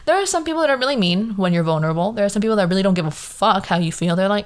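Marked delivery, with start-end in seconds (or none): none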